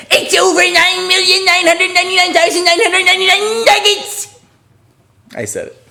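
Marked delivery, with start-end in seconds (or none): 0.1-4.4 screamed cartoon voice, very high and loud; all-out high-pitched character scream; Nugget. Shown as a demonstration. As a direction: shriek the whole catchphrase at the top of the range. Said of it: "I think I'm supposed to scream that".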